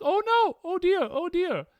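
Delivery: high, loud mock panic; panicky boyfriend. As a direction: squeal it like a flustered boyfriend. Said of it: "he was freaking out".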